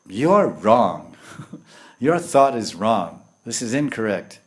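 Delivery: loud, forceful correction; emphatic rebuke. Acted. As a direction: declare the error firmly, as if correcting a disciple. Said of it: "And Lord Chaitanya is saying".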